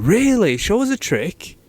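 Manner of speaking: impressed surprise. Acted. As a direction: react like an intrigued stranger. Acting out impressed stranger's reaction.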